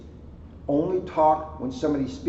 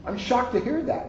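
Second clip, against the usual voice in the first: mock shock; 9 semitones higher than usual. Teasing about talkative daughter.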